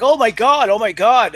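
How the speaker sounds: announcer losing it at the crash; shocked announcer. Retold or imitated. Announcer reacting to the ambulance crash, mock shocked. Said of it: "the announcer's like".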